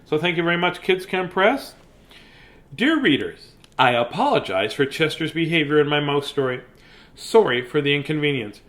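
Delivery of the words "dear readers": plain reading voice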